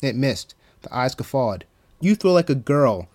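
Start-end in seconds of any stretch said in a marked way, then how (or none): 2.0-3.1 understated scream, loud jeer. A narrator's reading. Evil voice mocking Marigold's throw, mildly screamed. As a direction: jeer it with raised volume. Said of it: "the evil voice screamed".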